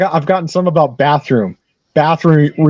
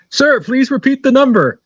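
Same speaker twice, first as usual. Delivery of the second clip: higher-pitched, mock-exasperated request; insistent telemarketer; 8 semitones higher than usual. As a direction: imitate a telemarketer losing patience. Retold telemarketer repeats his request, losing patience.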